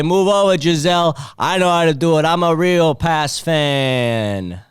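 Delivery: loud mock superfan; hammed-up Boston bro. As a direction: brag loudly like a Pats superfan. Comedian's stage persona.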